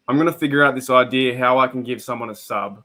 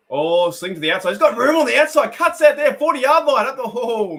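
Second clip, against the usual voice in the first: excited call; 10 semitones higher, 5 dB louder than usual. Real, not acted. Streamer calling a breakaway run for viewers, rising excited shout. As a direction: voice jumps high and loud as the run breaks.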